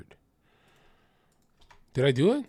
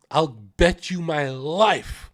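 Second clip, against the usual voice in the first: normal talking voice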